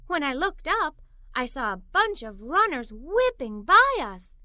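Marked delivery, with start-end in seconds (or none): none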